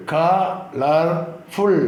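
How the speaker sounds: Colourful slowly as ക, ളർ, ഫുൾ; split into syllables. Shown as a demonstration. Teacher making three syllables for pupils, slowly split. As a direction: pause between the three beats of colourful. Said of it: "മൂന്ന് syllable ആക്കാം".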